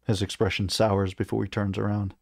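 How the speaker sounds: usual speaking voice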